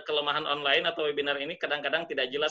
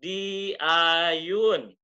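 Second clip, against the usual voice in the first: "diayun" drawn out in a swaying tone; very slow, lilting "di-a-yun"; swung intonation; 4 dB louder than usual. Shown as a demonstration. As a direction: stretch and swing each syllable of "diayun". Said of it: "Kadang-kadang diayun".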